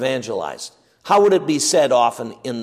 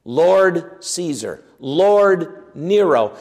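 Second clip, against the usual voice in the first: slow emphatic titles; much slower than usual. Shown as a demonstration. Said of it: "slow emphatic list of titles".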